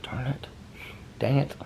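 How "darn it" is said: soft whispered curse; whispered darn it. Real, not acted. Annoyed vlogger whispering over the unplugged tree. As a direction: breathe it out under your breath.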